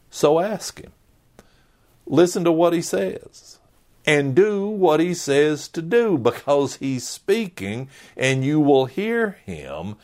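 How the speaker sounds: fervent call to listen; urgent appeal. Acted. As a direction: lift up and urge them on. Preacher urges the crowd.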